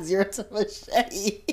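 slow words through real laughter; laughing it back in delight; laughing repeat. Real, not acted. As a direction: savour the phrase while laughing. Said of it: "Nice one".

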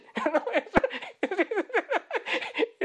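long, high-pitched laugh. Real, not acted. Preacher amused by a verse misread, laughing at length. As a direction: laugh in a run of quick high bursts.

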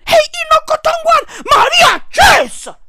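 high, loud disbelieving yell; outraged yell. Real, not acted. Ranting at the old man, outraged yell.

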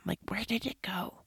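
whispered in wonder; hushed disbelief under the breath. Real, not acted. Marveling at time passing.

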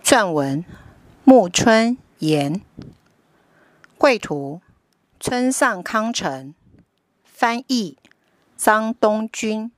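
put-on lively storybook narration for kids; reading to a toddler. A narrator's reading. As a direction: read it like a bedtime picture book. Picture book read to children.

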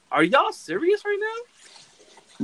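high-pitched, drawn-out disbelief; incredulous. Retold or imitated. Podcaster recalling his reaction to the chanting fans, incredulous. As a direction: retell it as a high, slow incredulous question.

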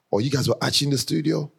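ordinary delivery

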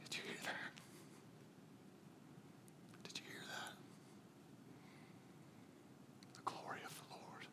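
hushed, barely voiced whisper; awed whisper. Real, not acted. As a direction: let it hang in a reverent whisper. Hushed echo after the proclamation.

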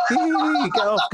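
high, laughing playful protest; laughing get off. Retold or imitated. On the toilet, pushing off a sniffing dog, laughing high. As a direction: giggle it out while pushing the dog off. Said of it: "just a bit of playfulness".

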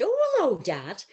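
high, loud joyful hello; delighted greeting. Retold or imitated. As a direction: greet your late dad with joy. Greeting dad in dream.

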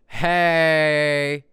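bright loud hey. Pretend. Greeting said two ways.

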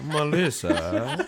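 slow, drawn-out snooty Melissa; Niles voice. Pretend. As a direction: stretch it out like a fussy Niles. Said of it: "in my Niles voice".

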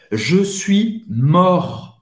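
"mort" ending on the R, T left silent. Shown as a demonstration. Teacher modelling the masculine form, "mort" with silent T. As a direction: say "mort" slowly with no T at the end. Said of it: "Você não vai pronunciar o T".

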